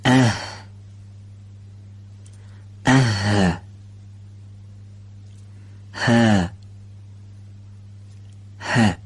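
isolated breathy h sounds with vowels; demo letter sounds. Shown as a demonstration. Teacher demonstrating the letter h to students, drawn out. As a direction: sound out each h slowly and clearly.

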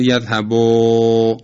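یذهبو with the final "o" held long; slow, sustained "yazhaboo" with an o sound in یذهبو; stretched wrong vowel. Shown as a demonstration. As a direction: drag out the last vowel of یذهبو as "ooo", never reaching "u". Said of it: "این ضمهٔ با رو امتداد بدیم".

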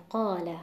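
قال said clearly with the weight on قا; Arabic word demo. Shown as a demonstration. Teacher demonstrating قال to Quran recitation students, stress on قا. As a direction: model قال slowly, stress on the first syllable قا.

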